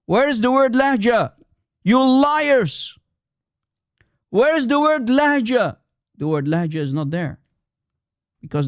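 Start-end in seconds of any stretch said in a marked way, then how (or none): 0.1-5.8 high-pitched, indignant demand; angry challenge. Real, not acted. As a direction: hurl it as a heated accusation. Challenging opponents, calling them liars.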